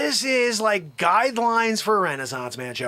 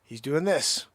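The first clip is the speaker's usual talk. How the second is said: ordinary talk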